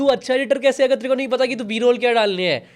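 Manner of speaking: exasperated scolding. Retold or imitated. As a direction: scold an imagined editor in disbelief. Acting out telling off an editor.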